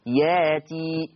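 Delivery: drawn-out ياتي with the hamza turned into a long a; showing the reading. Shown as a demonstration. Teacher showing students Warsh's reading, drawn out. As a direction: say ياتي slowly, vowel stretched, no glottal stop. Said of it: "ولذلك يصير ياتي".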